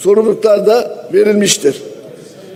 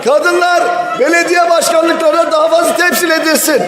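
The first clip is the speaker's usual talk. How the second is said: defiant shout; 7 semitones higher than usual. Real, not acted. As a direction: throw down the gauntlet at full voice. Heated protest at the podium. (meydan okurcasına bağırarak)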